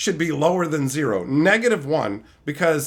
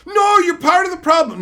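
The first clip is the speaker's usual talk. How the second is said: mock commenter; 10 semitones higher, 5 dB louder than usual. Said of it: "Magoos complaining".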